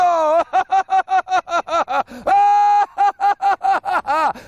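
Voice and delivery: high, loud maniacal laugh; evil villain cackle. Acted. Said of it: "el malo de una película de James Bond".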